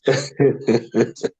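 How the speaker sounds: giggling fit; real laughter. Real, not acted. Finds kept wedding dresses quite funny, laughing freely. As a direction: give in to helpless chuckling. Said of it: "This is quite funny to me".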